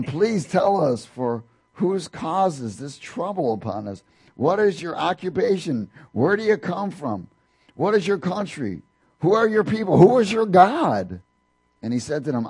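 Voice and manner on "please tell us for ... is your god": panicked sailors. Acted. Sailors interrogate Jonah in panic.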